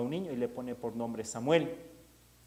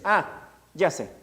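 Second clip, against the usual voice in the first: sudden realization; 5 semitones higher, 7 dB louder, slower than usual. Acted. Elí suddenly understands.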